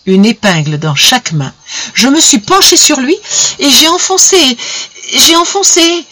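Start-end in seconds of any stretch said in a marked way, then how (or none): 1.9-3.2 rising horror. Acted. Wife confesses blinding her husband. 3.6-4.6 high, strained confession building to a peak; rising horror. Acted. Wife confesses blinding her husband. 5.1-6.0 even higher, strained repeat of "j'ai enfoncé"; the confession cracks at its highest pitch; peak of horror. Acted. Wife repeats the blow, higher still.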